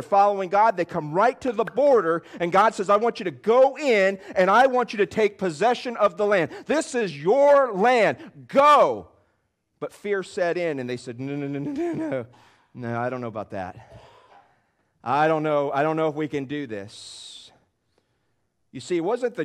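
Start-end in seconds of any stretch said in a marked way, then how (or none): 2.9-9.1 raised, forceful preacher's command; commanding as God. Acted. Preacher voices God's command. 11.2-17.4 mock reluctant stammer, mostly low; acted hesitant fear. Acts out the fearful Israelites.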